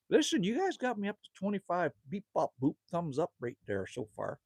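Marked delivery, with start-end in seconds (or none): none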